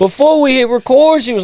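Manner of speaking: high-voiced complaint about being laughed at; high-pitched protest. Real, not acted. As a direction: start very high, then ease down, complaining. Complaining Kim was laughing.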